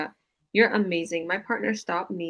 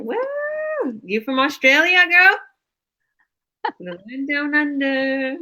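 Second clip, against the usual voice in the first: much higher and louder, thrilled; excited; 8 semitones higher, 9 dB louder, slower than usual. Real, not acted. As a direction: call out to her with delight, drawing out down under. Host excited by Australian viewer.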